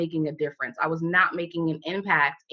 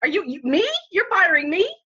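rising, higher, incredulous; can't believe she's being fired; mock panicked disbelief; shocked disbelief; 8 semitones higher, 3 dB louder than usual. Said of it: "was going crazy".

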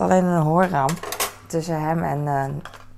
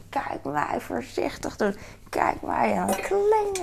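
mocking her own carefulness, higher and slow; a lightly hammed-up "look at me being careful", pitched up; mock proud self; 7 semitones higher, slower than usual.

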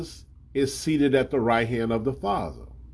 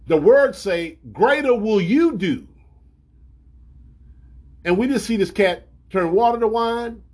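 higher, louder preaching at full force; fervent preaching; 7 semitones higher, 3 dB louder than usual. Acted. Preacher scolding the congregation about toilet tissue, fervent. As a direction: rebuke the flock with fire.

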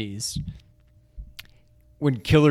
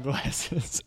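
normal talking voice

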